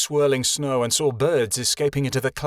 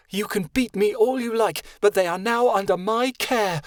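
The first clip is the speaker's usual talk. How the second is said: growled; 8 semitones higher than usual. Acted. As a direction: stand your ground, defiant. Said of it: "she growled".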